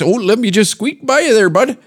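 high-pitched mock Minnesota accent. Minnesotan squeezing past someone, in mock Minnesota accent. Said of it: "The accent, how they ask".

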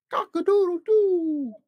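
very high, slow, loud crow; rooster crow. Acted. Dad reading Seuss to a child, long rooster crow. Final sound list.

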